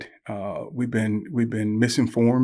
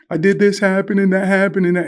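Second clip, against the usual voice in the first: put-on high, louder little-girl outpouring; imitated upset daughter; 8 semitones higher, 5 dB louder than usual. Crying daughter telling her dad what happened, mock upset. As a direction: mimic a crying child spilling out her troubles. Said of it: "she's just getting it out".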